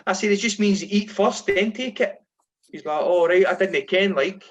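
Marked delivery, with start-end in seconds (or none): 0.1-2.2 exasperated explaining. Retold or imitated. Explaining to teammate in disbelief. 3.0-4.4 put-on Edinburgh accent. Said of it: "he's sort of Edinburgh accent".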